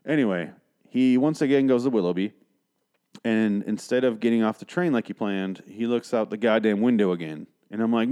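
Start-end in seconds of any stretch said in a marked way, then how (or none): none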